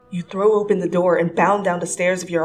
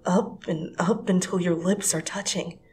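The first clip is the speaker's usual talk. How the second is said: usual speaking voice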